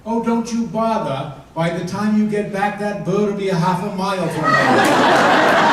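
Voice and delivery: high, loud, slow captain's reply; gruff captain retort. Acted. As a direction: deliver the captain's punchline big and loud. Punchline, captain's retort voiced.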